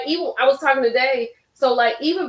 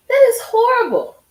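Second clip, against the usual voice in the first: much higher and louder, appalled; outraged cry; 13 semitones higher, 7 dB louder than usual. Real, not acted. Host reacting to testimony, loud appalled cry. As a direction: shout out your disgust.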